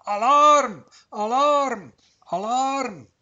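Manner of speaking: high, loud alarm call that fades on the last word. Acted. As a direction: cry the alarm, then let it fall away. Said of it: "ruft der Wurzel".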